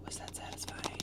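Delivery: soft unvoiced ASMR whisper, close to the mic; whispered ASMR demo. Shown as a demonstration. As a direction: breathe the words out for tingles. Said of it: "A S M R, A S M R".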